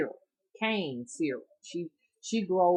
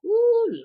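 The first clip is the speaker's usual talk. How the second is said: ooh; 12 dB louder than usual. Real, not acted. Reaction to granny scolding.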